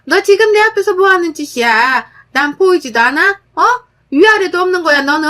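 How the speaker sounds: mother-in-law shouting at the daughter-in-law, furious; mother-in-law yelling. A narrator's reading. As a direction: yell at her for disrespect. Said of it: "그러더라고요".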